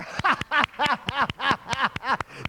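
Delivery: loud, high acted guffaw; roaring crowd laugh.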